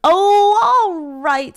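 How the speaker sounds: loud high giving-in troll. A narrator's reading. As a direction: grumble it like a beaten troll. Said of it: "said the troll".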